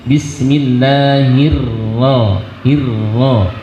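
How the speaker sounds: "Bismillahirro" with the rr held a moment; held double ro. Shown as a demonstration. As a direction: linger on the doubled r. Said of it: "ro-nya ada tasjid, maka sedikit ditahan". (ro bertasydid sedikit ditahan)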